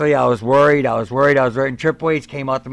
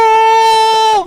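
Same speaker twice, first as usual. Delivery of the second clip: long, very high, loud wail; acted sustained shriek; mock scream of pain; 7 dB louder than usual. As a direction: howl in hammed-up agony. Acting out his scream of pain.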